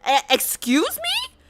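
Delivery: high, slow, stammered outrage; can't believe what just happened; startled excuse-me, pitch leaping up; shocked disbelief. Real, not acted. Stranger touches her unexpectedly.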